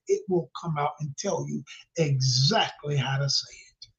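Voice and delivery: hushed, secretive whisper. Real, not acted. Telling viewers what Coach Ken's software does, in a whisper.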